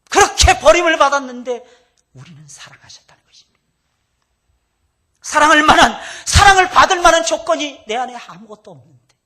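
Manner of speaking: loud high crying-out preaching; full-force pulpit shout. Acted. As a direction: throw it out to the whole hall at the top of the voice.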